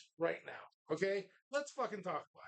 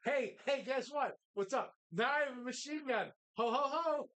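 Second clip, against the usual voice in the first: perky, higher mock voice, missing the menace; botched movie quote; 3 semitones higher than usual. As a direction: quote it cheerily with no accent. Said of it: "You didn't even say it right".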